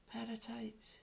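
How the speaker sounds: breathy hushed repeat; soft unvoiced murmur to herself; whispered aside. Shown as a demonstration. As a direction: whisper the words to yourself. Muses about tights on the camera.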